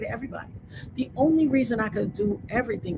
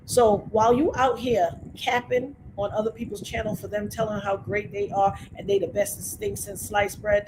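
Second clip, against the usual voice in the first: normal talking voice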